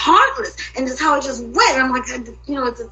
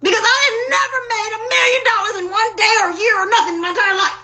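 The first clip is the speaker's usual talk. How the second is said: raised, breaking voice near tears, faster; crying out; 7 semitones higher, faster than usual. Real, not acted. Ranting to the camera about the money, crying shout. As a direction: cry it out, fast and high. Venting, voice cracking.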